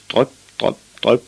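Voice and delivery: short, high spaced drops; dripping imitation. A narrator's reading. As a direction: say each word like a falling drop.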